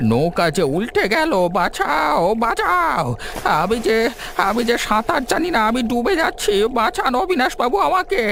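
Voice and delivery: high, frantic drowning pleas; drowning cries for help. Acted. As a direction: scream for help as you sink.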